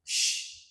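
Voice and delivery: whispered sh, soft and hushing; a quiet hissed sh; the sh sound. Shown as a demonstration. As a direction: breathe out a clean sh. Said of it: "ki gen swa son".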